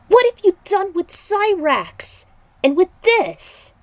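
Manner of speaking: high, loud shaken demand; alarmed demand. Acted. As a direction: demand an answer about Cyrax. Aiko demands about Cyrax.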